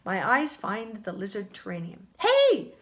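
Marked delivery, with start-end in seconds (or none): 2.2-2.7 loud, high burst of surprise; startled shout of discovery; sudden exclamation. A narrator's reading. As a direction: call out suddenly. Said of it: "I say suddenly".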